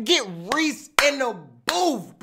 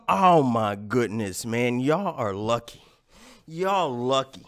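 annoyed grumble; 12 semitones lower, 6 dB quieter than usual. Real, not acted. Irritated at chat.

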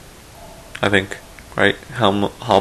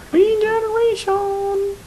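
high, slow cowboy yelp; Western accent; slower than usual. Pretend. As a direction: whoop it like a cowboy. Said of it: "talking in, like, a Western accent".

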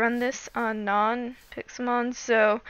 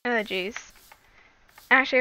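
usual speaking voice

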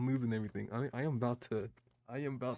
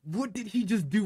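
loud, high disbelieving outburst; appalled shouted question; shocked outburst; 7 semitones higher, 11 dB louder than usual. Real, not acted. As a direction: yell it in disbelief at what you just saw. Shocked reaction to the clip.

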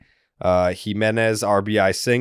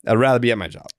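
ordinary talk, nothing marked

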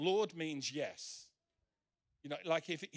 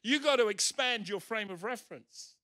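loud, raised, emphatic insistence; insistent command; 5 semitones higher, 8 dB louder than usual. Retold or imitated. Preacher voicing God answering Peter's refusal, loud and insistent. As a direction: override the objection with loud, high urging. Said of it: "And God is saying".

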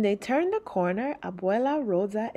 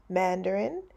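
ordinary delivery, nothing marked

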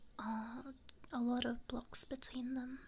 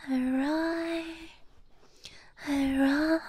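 playful roar; 3 semitones higher, 10 dB louder than usual. Pretend.

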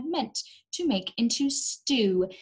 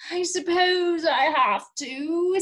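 higher, louder, slow reluctant voice; reluctant sigh; 8 semitones higher, 5 dB louder, slower than usual. Acted. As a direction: give in unwillingly. Reluctant decision.

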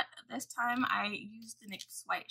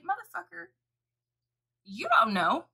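retold angry rant; 8 semitones higher, 6 dB louder than usual. Retold rant at old man.

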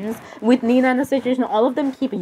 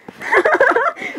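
high giggle. Acted. Character giggles over Jeff.

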